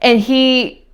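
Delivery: usual speaking voice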